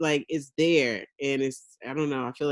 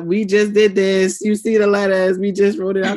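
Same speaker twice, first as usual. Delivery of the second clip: impatient mom; 4 semitones higher, 7 dB louder than usual. Retold or imitated. Mother losing patience with her kids over letters, exasperated. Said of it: "I don't really have that much patience".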